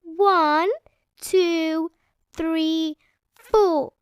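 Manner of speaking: put-on high child counting; Peppa counting. A narrator's reading. Peppa counting tomatoes, high and proud. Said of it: "Peppa counted".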